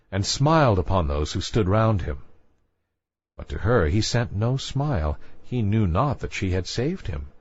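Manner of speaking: plain reading voice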